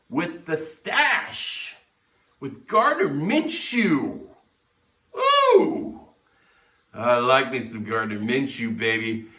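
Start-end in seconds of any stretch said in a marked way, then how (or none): 5.2-6.0 high, loud delighted squeal; a sudden thrilled high whoop; excited squeal. Real, not acted. Fan hyped about his quarterback, high squeal.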